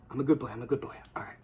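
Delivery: low, soft murmur to himself, fading away; self-soothing. Real, not acted. Reviewer calming himself after tearing up, soft and low. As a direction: talk yourself down gently after nearly crying.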